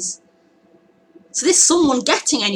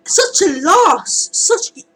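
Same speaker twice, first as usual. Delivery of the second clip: laugh into tears; 12 semitones higher, 5 dB louder, much slower than usual. Real, not acted. Recalling despair, laugh then cry.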